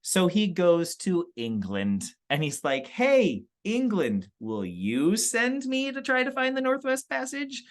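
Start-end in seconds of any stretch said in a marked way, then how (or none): 2.9-7.6 high-pitched, playful plea; hopeful request. Acted. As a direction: act out asking for a favour. Explorer asking England for a voyage.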